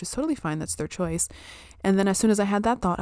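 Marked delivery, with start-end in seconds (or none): none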